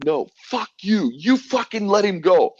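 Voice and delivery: high, loud furious yell; defiant angry retort. Retold or imitated. Defying police who beat his friend, retold furious high shout. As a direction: hurl it back at the police in fury. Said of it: "I'm like".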